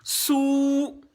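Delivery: "Sou" held long on a high note; drawn-out vowel. Shown as a demonstration. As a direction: stretch the oo of "Sou". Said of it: "si on veut tirer".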